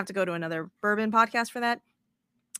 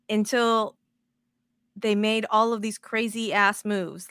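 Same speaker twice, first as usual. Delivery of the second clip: normal talking voice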